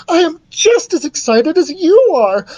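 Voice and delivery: high, delighted gushing; excited cry. A narrator's reading. Queen assumes son shares her joy, excited. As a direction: gush at the top of the register. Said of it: "she cried".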